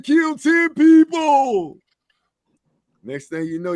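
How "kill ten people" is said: high, loud, drawn-out holler; mock outcry. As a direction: holler it slowly and high, stretching each word. Mock outcry about mass shootings.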